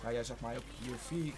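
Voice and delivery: ordinary talk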